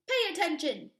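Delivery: loud, sharp teacher's snap; snapping. Acted. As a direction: bark it at the student. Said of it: "snaps Maestro".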